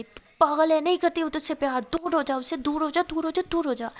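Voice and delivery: loud, high frantic yelling; screaming at her friend to stay away; shouting. Acted. Aashi yells at the imagined friend to go away, shouting. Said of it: "ज़ोर ज़ोर से चिल्ला रही होती है".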